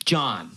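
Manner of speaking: "John" after a long hesitation; hesitant name. Retold or imitated. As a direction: pause as if thinking, then say "John". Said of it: "You had to think about it".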